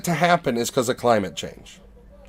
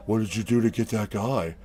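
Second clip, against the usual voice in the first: very low, quiet mock superhero voice; imitated brooding hero, low and soft; 10 semitones lower, 11 dB quieter than usual. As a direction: drop it way down, under the breath.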